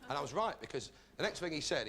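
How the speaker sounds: ordinary talk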